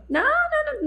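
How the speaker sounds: high-pitched, repeated mock refusal; imitated denial. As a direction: deny it in a high put-on voice. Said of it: "E o Fabrício negando". (negando, voz aguda imitada)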